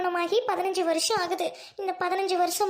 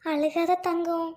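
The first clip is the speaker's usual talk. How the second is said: soothing; 2 semitones lower, slower than usual. Acted. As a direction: hush the little one tenderly. Comforting crying child. (அழும் குழந்தையை மென்மையாகத் தேற்றுதல்)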